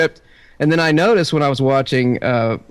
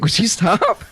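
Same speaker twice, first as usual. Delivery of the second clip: higher, giggly reprimand; mock protest; 8 semitones higher, slower than usual. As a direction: imitate a wife trying not to laugh. Imitates wife, amused protest.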